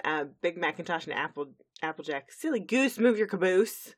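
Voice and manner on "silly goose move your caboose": high, raised granny holler; mock yell. Granny Smith hurrying her grandchildren along, mock yelling. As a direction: scold them loudly in play. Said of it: "we get Granny Smith yelling at".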